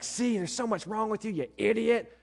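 high-pitched, scolding mock insult; accusing jab. Preacher mimics a spouse blaming their partner, accusing and high. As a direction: pitch it up and rub the blame in. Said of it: "you're like".

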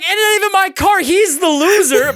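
high-pitched comic outrage, laugh creeping in; worked-up, half-laughing protest; retold indignation. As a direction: protest the injustice in mock anger. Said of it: "I was pissed".

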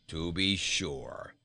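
slow, scornful goat voice. A narrator's reading. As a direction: sneer it like a disdainful goat. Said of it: "said Bilbil scornfully".

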